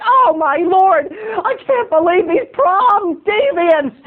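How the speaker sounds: high, slow, quavering gasp of shock; shocked waiters. Retold or imitated. Waiters walking by the table, high slow shocked quaver. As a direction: clutch your pearls and gasp slowly, up high. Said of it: "They're like".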